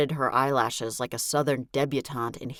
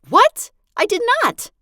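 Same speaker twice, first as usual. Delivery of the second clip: much higher, loud outraged yelp; a sharp, far higher protest; shocked denial; 10 dB louder than usual. Acted. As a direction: blurt it out, caught off guard. Eden shocked by the accusation.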